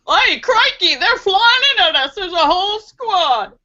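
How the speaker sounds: a hint of Australian Crocodile Dundee spotting a threat; Crocodile Dundee impression. Streamer imagining Crocodile Dundee under attack, lightly Australian and excited. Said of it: "Crocodile Dundee would have a jetpack".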